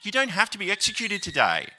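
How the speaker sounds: high, fast, coaxing plea to let him off; pleading governor. Retold or imitated. Governor begging Polycarp to sacrifice, high and fast coaxing. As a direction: coax him like a desperate official trying to bargain. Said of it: "He's like".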